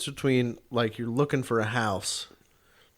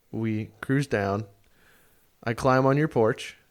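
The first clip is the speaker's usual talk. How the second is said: usual speaking voice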